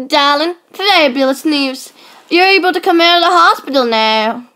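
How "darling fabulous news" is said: normal talking voice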